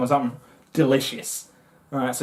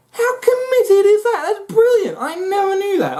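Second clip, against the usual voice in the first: amazed. Real, not acted. Marvelling at the mantis.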